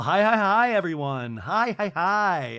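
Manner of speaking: high, loud string of hellos; mock-effusive hello to the whole table; imitated gushing greeting. Acted bubbly arrival greeting the table.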